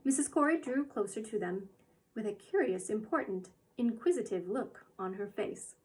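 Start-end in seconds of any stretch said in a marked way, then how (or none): none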